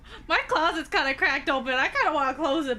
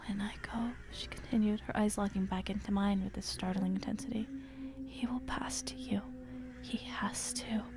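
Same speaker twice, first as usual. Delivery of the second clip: hushed, breathy whisper read; whispered storytelling; 6 semitones lower, 8 dB quieter than usual. A narrator's reading. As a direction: draw listeners in with a whisper.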